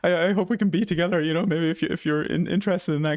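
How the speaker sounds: high-pitched imitation of a guy confessing love; mock outpouring from a smitten friend; lovestruck confession. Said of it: "spills out up his guts".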